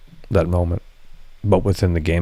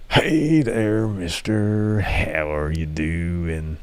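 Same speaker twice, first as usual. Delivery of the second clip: creepy greeting; 4 dB quieter, much slower than usual. Pretend. Streamer greeting a new viewer in a creepy drawn-out voice.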